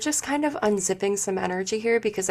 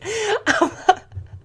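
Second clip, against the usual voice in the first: high burst of helpless laughter; real laugh. Real, not acted. Healer amused by what she just told a client, laughing high. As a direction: crack up, high-pitched. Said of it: "that sounds really funny".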